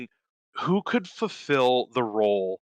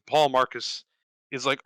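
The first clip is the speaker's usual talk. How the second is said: ordinary talk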